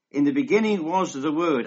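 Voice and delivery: measured pulpit reading voice; scripture reading. Acted. Reads scripture aloud.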